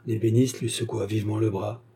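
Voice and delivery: ordinary delivery, nothing marked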